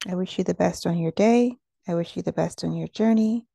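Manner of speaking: normal talking voice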